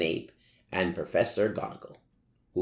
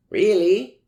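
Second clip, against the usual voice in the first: higher, louder hopeful question; sensitive; 12 semitones higher, 10 dB louder than usual. A narrator's reading. Draco asking girlfriend if she means it, hopeful. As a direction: ask it hoping to be reassured. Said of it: "asked Draco sensitively".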